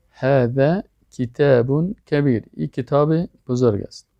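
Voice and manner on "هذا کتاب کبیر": slow Arabic, word by word with long vowels; model sentence. Shown as a demonstration. Teacher repeating the example sentence, slow model reading. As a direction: say هذا کتاب کبیر slowly and deliberately for learners.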